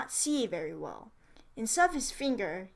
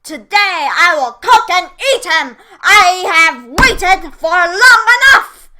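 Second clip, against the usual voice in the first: witch shouting; 7 semitones higher, 19 dB louder than usual. A narrator's reading. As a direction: bellow it like a hungry witch who has lost patience. Witch announcing she will cook Hansel.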